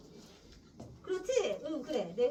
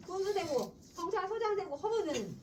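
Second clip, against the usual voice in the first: put-on higher, louder old man lecturing; self-righteous grandfather; 8 semitones higher, 3 dB louder than usual. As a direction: scold like a stingy old patriarch. Teacher voices grandfather's self-justifying lecture.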